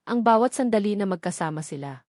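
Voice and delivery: plain reading voice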